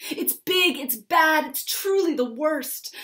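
a run of high, loud panicked cries; crying out. Acted. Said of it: "cried Jim as he ran".

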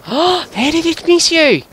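gasp, then high, slow disbelief; astonished disbelief. Real, not acted. Gamer gasping at a missed shot, astonished. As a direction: squeal it out in astonishment.

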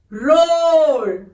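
long drawn-out roar, rising then falling; teacher's mild lion roar; lion sound. Shown as a demonstration. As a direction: stretch the word like a gentle lion's roar. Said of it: "the sounds of animals".

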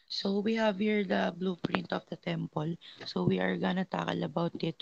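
faint, far from the mic; words barely reaching the mic; barely audible. Real, not acted. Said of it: "your voice is very faint".

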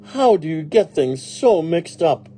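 high, loud scolding question; exasperated employer. Acted. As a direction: scold the maid in disbelief.

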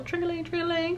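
high, soft sing-song ringing; bell jingle. Pretend. Creator mimicking the subscribe bell, high and soft. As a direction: chime it like a little bell.

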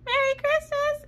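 gleeful high wake-up call; sing-song high greeting. Retold or imitated. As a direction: sing it out brightly to wake him. Waking husband on Christmas.

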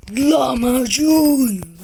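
slow, loud war cry; drawn-out battle cry. Acted. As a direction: stretch it out like an attack name. (uzatılmış savaş çığlığı)

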